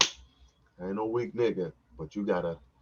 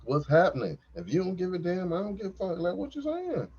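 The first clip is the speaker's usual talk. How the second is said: higher, louder pep talk to himself, rising to the end; hyped pep talk; 9 semitones higher, 4 dB louder than usual. Retold or imitated. Man talking to his own member, hyped pep talk. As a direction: pump him up like a coach. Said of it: "I'm talking to him like".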